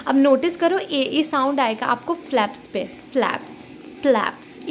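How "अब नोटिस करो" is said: normal talking voice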